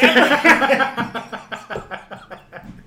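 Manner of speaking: long, loud, high cackle; real helpless laughing fit. Real, not acted. Cracking up at own impression.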